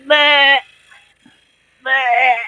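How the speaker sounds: high, loud gagging goat noise; mock goat gag; goat gagging bleat. Shown as a demonstration. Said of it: "it looks like they're gagging".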